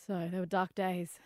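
normal talking voice

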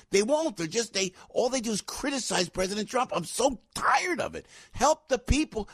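high-pitched, exasperated rant; fired up. Real, not acted. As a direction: plead and demand, voice pitched well up. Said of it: "I get fired up".

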